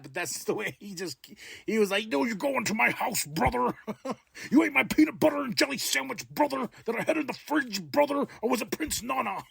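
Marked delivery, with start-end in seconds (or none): 2.1-9.4 higher, faster mock promo, yelling brother each line; over-the-top mock promo rant; hammed-up wrestling promo. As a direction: rant like a raging pumped-up wrestler. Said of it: "He didn't say any of that".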